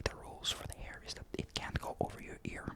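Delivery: ordinary delivery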